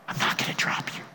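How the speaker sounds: intimate whisper of reassurance; God's promise whispered over the people. Pretend. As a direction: breathe it softly like holding someone close. God speaking over his people.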